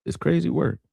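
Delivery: high, soft falling crazy work. Real, not acted. Repeating phrase for emphasis.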